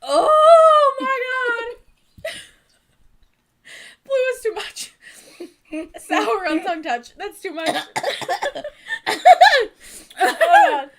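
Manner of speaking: high, loud laughing cry; laugh-cry through the sourness. Real, not acted. Overwhelmed by sour candy.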